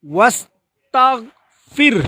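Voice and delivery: ওয়াস্তাগফির slow, with the গ held, not bounced; correct Arabic pronunciation. Shown as a demonstration. Teacher models ওয়াস্তাগফির for learners, with the গ held. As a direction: recite ওয়াস্তাগফির slowly and hold the গ. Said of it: "আবার".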